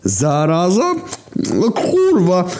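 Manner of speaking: wysoki, radosny okrzyk; joyful yell. A narrator's reading. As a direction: krzyknij z radości na widok kumpla. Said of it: "wrzaśnął radośnie Głód". (Głód radośnie wita brata, głośny wysoki okrzyk)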